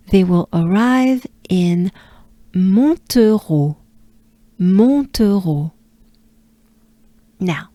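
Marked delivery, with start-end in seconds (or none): 2.5-5.7 Montereau slowly, nasal first vowel, falling end; French pronunciation. Shown as a demonstration. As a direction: model French "Montereau" syllable by syllable. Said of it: "let's break it down".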